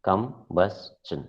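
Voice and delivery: Combustion very slowly, kum, bus, chun; syllable by syllable. Shown as a demonstration. Teacher repeating the three-syllable count, syllable by syllable. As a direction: pause between the three beats of Combustion. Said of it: "Three syllables".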